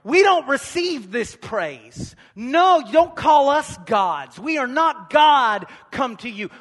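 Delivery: loud, slow pleading cry of the apostles; urgent shout. Retold or imitated. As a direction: shout them down from worshipping you. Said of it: "They said".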